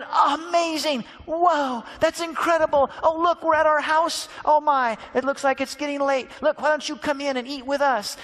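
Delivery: high, loud, amazed exclamations. Acted. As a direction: gush with wonder at the stranger. Disciples amazed on the road.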